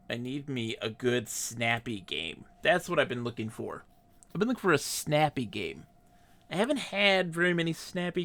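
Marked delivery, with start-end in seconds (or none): none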